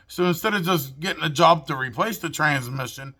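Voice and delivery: ordinary talk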